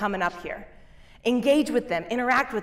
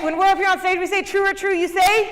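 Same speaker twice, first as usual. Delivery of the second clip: higher and louder, calling out to the hall; rally call; 8 semitones higher, 4 dB louder than usual. Shown as a demonstration. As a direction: pump up the room for the answer. Leading audience call-and-response.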